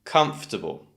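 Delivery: comfortable as KUMF-tuh-bul; comfortable in three syllables; silent O. Shown as a demonstration. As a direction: leave out the O after F in comfortable. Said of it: "has an extra sound that we don't pronounce".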